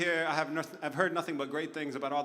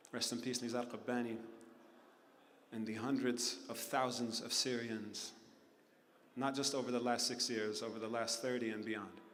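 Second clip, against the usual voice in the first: much softer, hushed memorial words; solemn tribute; 5 semitones lower, 7 dB quieter than usual. Real, not acted. Tribute to the dead.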